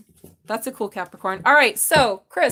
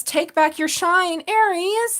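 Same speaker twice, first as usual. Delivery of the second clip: slow, high, louder proclamation; grand card reading; proclaiming; 9 semitones higher, 6 dB louder, much slower than usual. Acted. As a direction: declare it grandly.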